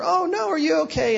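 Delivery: high, hammed-up concern; high mock concern; mock alarm. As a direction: pretend shock as the doll falls. Mock concern for fallen doll.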